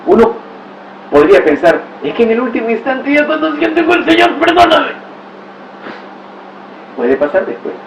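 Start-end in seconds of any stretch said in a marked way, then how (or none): 2.0-4.9 high pleading voice of a dying man; high imagined plea for forgiveness; pleading sinner. Retold or imitated. Imagined last-moment plea.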